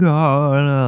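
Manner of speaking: high, loud wail; hammed-up cry of panic; mock freaking out. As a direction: imitate a kid wailing in dismay. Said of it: "freaking out".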